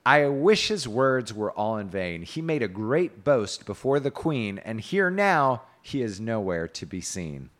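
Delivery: slow, formal storyteller's quoting; declaimed knight's speech. A narrator's reading. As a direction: recite the knight's complaint with measured emphasis. Reads Sir Kay's speech.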